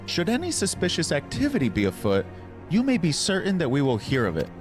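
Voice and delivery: high, regal queen voice; Queen Minfilia voice. Acted. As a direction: speak as a gracious queen. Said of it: "my Queen Minfilia voice".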